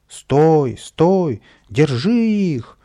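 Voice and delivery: high, loud, drawn-out chase shout; громкий крик. A narrator's reading. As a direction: holler after runaways. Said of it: "громко кричал садовник". (громко кричит вдогонку)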